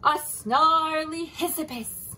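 slow, loud monster name; dramatic. Acted. Giraffe telling Elephant the monster's name dramatically. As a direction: draw out the scary name.

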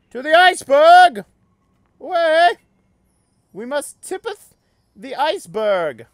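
loud, high rallying cry; rally the troops grandly; mock heroic call.

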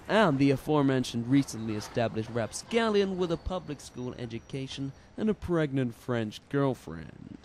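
normal talking voice

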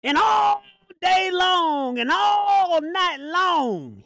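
high, slow, wailing shout; long-held pulpit shout; preacher's drawn-out cry. Real, not acted. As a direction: stretch ALL and LONG in a high holler. Preacher cries out the long call.

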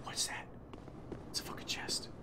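very soft whispering; hushed whisper. Real, not acted. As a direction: whisper it under your breath. Tense muttering while exploring.

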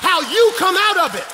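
high, loud, shouted exhortation; full-force preaching. Real, not acted. Preacher telling the congregation God uses their problem, shouted preaching. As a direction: cry it out to the whole church, phrase by phrase.

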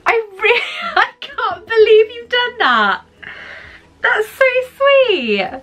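choked-up, teary thank-you through tears; overwhelmed and crying, breaking up; laugh-crying with gratitude, voice cracking; real tearful crying. Real, not acted. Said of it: "I'm just gonna start to cry now".

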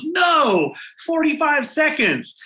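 high-pitched mock-incredulous no; emphatic retold correction. Principal correcting the guess about dance length, exclaiming.

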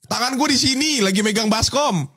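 high, indignant acted protest; protest, hammed-up, as if wrongly accused; mock outrage. Acted quarrel over being called thief.

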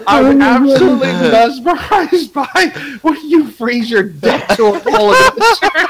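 stoner. Acted. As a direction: read it like you're baked. Keeps reading as a stoner.

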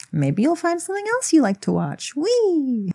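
high, playful singsong voice; silly put-on voice. YouTuber plugging other videos on the channel, silly high voice. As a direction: put on a goofy high voice, stretching the final squeal. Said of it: "I don't know why I did that voice".

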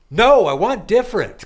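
high, loud insistent reply; emphatic. Retold or imitated. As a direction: push back firmly. Retold reply to barista.

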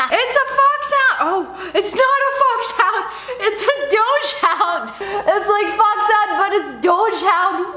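high, laughing through the words. Real, not acted. Opening a fan's gift, laughing at the doge print. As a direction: giggle out the doge joke.

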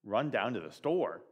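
ordinary delivery

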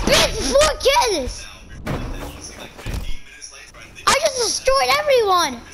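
high, drawn-out gloating over the kills; excited boasting. Real, not acted. Gamer bragging after wiping the lobby, excited boast. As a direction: savor the win, stretching each word.

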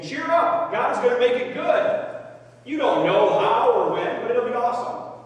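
high, loud mock pep talk; pushy, oblivious encouragement; insensitive cheering-up. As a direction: chirp at the sufferer to cheer up. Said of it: "That's insensitive".

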